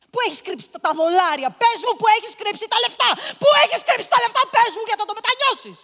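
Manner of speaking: high, loud, fast furious yelling; retold shouting at the girl about the money; screaming interrogation. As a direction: build the demand into a rage. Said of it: "τη ρωτάω".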